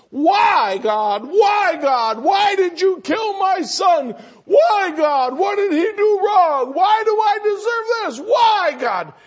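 very high, loud anguished wail at God; grief-stricken screaming. Retold or imitated. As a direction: act out a bereaved mother screaming at the top of her lungs. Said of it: "she starts screaming".

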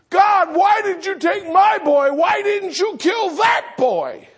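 high, loud cry of accusation at God; anguished screaming. Retold or imitated. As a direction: act out a grieving mother shouting at heaven. Acts out the screaming mother again.

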